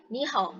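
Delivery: "ni hao" with a half third tone at the end; natural tone change. Shown as a demonstration. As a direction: say "ni hao" compactly as Chinese speakers do. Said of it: "orang Cina kayak gini".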